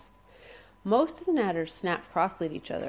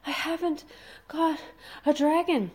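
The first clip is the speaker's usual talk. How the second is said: panted; 10 semitones higher, 4 dB louder than usual. A narrator's reading. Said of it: "panted Fish Legs".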